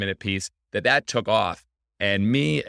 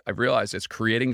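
usual speaking voice

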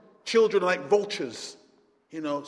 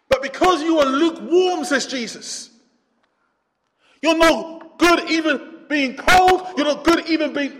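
high, loud preacher's cry; fiery preaching; 8 semitones higher, 8 dB louder than usual. Acted. Pastor preaching to the church in a loud fiery voice. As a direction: preach it hard at the congregation.

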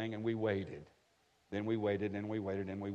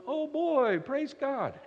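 high, drawn-out mock cheer; mock rejoicing; 14 semitones higher, 4 dB louder, much slower than usual. Dad facing a big college bill, mock rejoicing. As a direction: put on a much higher rejoicing voice. Dad reacting to daughter's expensive news.

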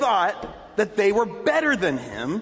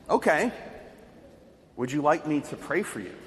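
softer, gentle, unbothered offer to pray; calm reply; 4 semitones lower, 4 dB quieter than usual. Retold or imitated. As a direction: answer calmly, no fear at all. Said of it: "he replied calmly".

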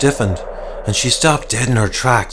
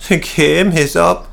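put-on higher, slow tearful old man; sobbing; 7 semitones higher, slower than usual. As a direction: choke out the words in despair. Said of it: "he sobbed".

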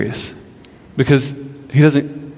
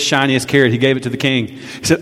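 usual speaking voice

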